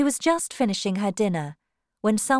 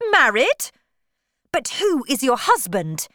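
raised, indignant voice, jumping up in pitch; angry questioning; 6 semitones higher, 6 dB louder than usual. A narrator's reading. Rejected suitor questioning her about her husband, angry. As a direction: demand an answer, angrily. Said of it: "he asked angrily".

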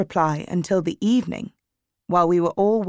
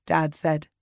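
usual speaking voice

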